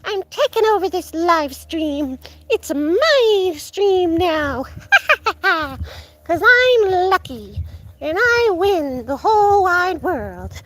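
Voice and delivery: high, loud cartoon villain with cackles; evil Lucky. Owner voices the cat seizing the livestream, hammed-up evil villain. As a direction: gloat like a tiny villain, drawing out the big words. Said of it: "he turns into evil Lucky and he goes".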